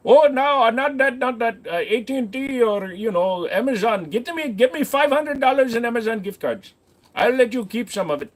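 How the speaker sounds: put-on high Indian accent, hurried and pushy; scammer accent. Scammer demands Amazon gift cards in an Indian accent. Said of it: "stop doing that accent".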